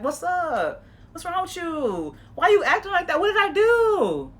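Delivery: wrong way, rising and pushy; confrontational questioning. Shown as a demonstration. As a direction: demand answers with an edge. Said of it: "don't say it like that".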